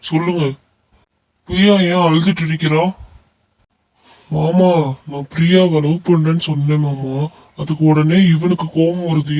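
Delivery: usual speaking voice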